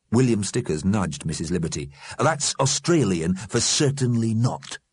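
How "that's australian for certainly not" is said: ordinary delivery, nothing marked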